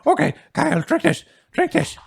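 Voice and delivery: high, loud urgent coaxing; urgent old man. Acted. Herb urging the unconscious Kyle to drink, high urgent coaxing. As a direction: press the drink on him.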